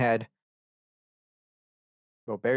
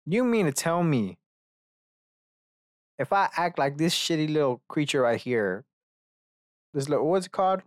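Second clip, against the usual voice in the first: incredulous; 5 semitones higher than usual. Acted. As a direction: question it like it's utterly absurd. Acting out disbelief at the advice.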